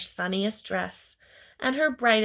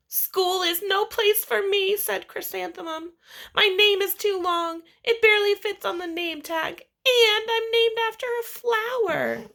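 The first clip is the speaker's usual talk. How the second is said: high, loud, whiny complaint; upset child; 11 semitones higher, 5 dB louder than usual. Acted. Little girl complaining to her parents about school. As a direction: wail it like a hurt kid.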